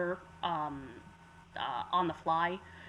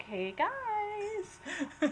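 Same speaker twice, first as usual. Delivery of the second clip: high, sing-song cheery greeting; 13 semitones higher than usual. Retold or imitated. Game master gently getting players' attention, cheery voice. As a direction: get their attention with put-on cheeriness. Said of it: "a cheery voice".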